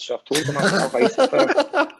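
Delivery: high, loud words through a real laugh. Real, not acted. Laughs while telling story.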